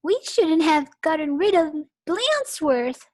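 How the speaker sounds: high, loud lament; regretful kids.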